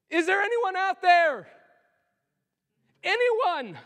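high, loud call to the room. Real, not acted. Preacher calling out to his congregation, high and loud. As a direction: reach for anyone at the back.

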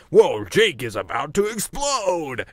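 slow game announcer call; lightly voiced race announcer; Loudmouth Larry. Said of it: "He's like".

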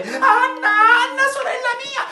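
very high, thin calling voice; desperate sky-high calling; crying out. A narrator's reading. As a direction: call to your sister, far up in pitch. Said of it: "e da capo a gridare".

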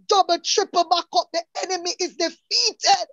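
fervent preaching. Real, not acted. Testifier encouraging somebody on the line, fervent preaching. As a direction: stretch each phrase as a rallying declaration.

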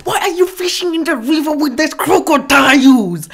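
ordinary talk, nothing marked

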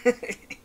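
high, loud snicker; a short mocking snigger. Papyrus laughing at his embarrassed brother, high snicker. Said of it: "Papyrus snickered".

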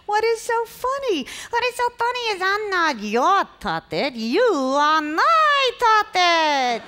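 ventriloquist puppet voice, lips still; muffled puppet talk without moving lips; talking with mouth closed. Acted. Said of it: "I'm a ventriloquist".

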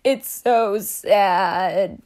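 very slow, drawn-out weeping line; crying shark. Acted. Crying shark at the bottom, very slow and sad. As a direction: stretch it out like a sobbing shark. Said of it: "The shark's crying".

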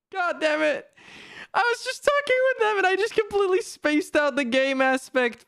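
high-pitched complaining wail; high, dismayed self-blame; whiny exasperation. Real, not acted. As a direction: whine your excuse way up high. Still lamenting the blunder.

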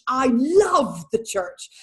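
a lingering second take that rises on church; tender echo. Real, not acted. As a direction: say it again gently, as if to herself. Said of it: "repeats the line with emphasis".

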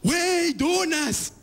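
thrilled to find donuts; high excited stoned shout; excited stoner. Retold or imitated. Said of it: "Juan Pacheco".

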